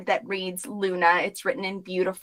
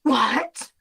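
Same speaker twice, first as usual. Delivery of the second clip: high, gasping shock; high horrified squeal; 8 semitones higher than usual. Acted. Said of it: "she goes, gasps".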